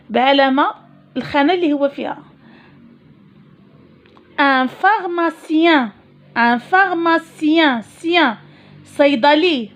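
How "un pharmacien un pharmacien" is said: pharmacien said slowly and clearly; slow model word. Shown as a demonstration. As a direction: spell out pharmacien for the class. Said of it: "teacher models word".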